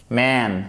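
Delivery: Man with vowel between A and è; Man in English, A leaning toward è; English short A. Shown as a demonstration. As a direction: model Man as English "mæn". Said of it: "on entre le A et le E".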